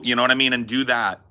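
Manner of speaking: usual speaking voice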